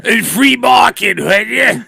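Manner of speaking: high, loud hammed-up Trump; mock Trump. Friend showing how the Trump should sound, hammed-up. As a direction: ham up a Trump line. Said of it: "If you just went".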